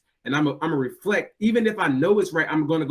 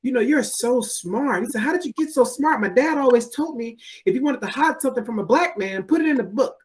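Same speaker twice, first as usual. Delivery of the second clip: kindergartner voice; 8 semitones higher than usual. Retold or imitated. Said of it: "and said".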